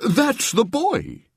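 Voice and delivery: high, slow recognition; surprised bishop. A narrator's reading. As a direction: recognize him in amazement. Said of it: "he said".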